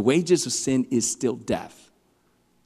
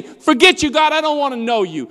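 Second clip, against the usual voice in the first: mock defiance; 10 semitones higher, 5 dB louder than usual. Mocking a defiant sinner.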